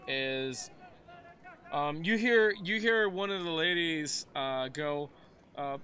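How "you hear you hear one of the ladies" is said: normal talking voice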